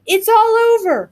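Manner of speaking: high, alarmed shout from the brother. A narrator's reading. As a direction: shout it as a cry of doom. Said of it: "shouted her brother".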